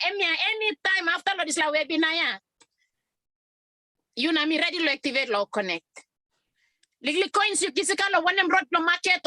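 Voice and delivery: speaking Papua New Guinea Pidgin. Real, not acted. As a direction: switch into fluent Pidgin. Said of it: "speak in, uh, Pidgin".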